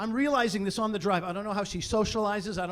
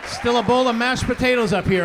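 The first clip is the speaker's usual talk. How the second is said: exasperated shout; 4 semitones higher, 8 dB louder than usual. Real, not acted. Comic rant about brain fog.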